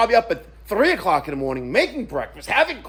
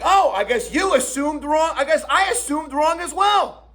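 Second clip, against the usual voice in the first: high-pitched mock realisation; retold sarcastic comeback; 8 semitones higher than usual.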